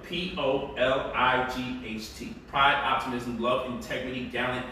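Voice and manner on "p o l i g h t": letter by letter, slow; spelled out slowly. Shown as a demonstration. Host spelling the app name for viewers, slow. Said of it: "the correct way to spell it".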